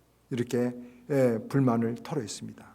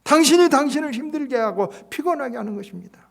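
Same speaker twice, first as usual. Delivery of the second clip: loud, much higher, "당신이" stressed; preached climax; 11 semitones higher, 8 dB louder than usual. Acted. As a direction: drive the final point home. Jung's rebuke climax.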